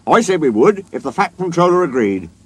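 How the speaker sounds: high, loud, lively news-breaking; excited. A narrator's reading. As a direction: blurt out the big news. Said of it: "looking excited".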